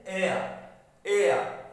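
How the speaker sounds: एयर as a gliding "ay-a"; vowel sound demo. Shown as a demonstration. Teacher drilling the first vowel sound, falling gliding एयर. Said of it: "इसको बोलेंगे एयर".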